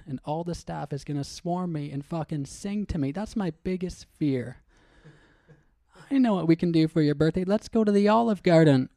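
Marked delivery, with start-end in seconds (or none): 6.1-8.9 loud, eager suggestion; eager friend. Acted. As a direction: pitch it brightly and loud. Acted friend suggesting Olive Garden.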